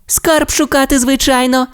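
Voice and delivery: very high, matter-of-fact little voice; little Timmi. A narrator's reading. Timmi tells papa Rex they go treasure hunting, very high. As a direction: answer as if it's obvious. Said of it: "сказав Тімі".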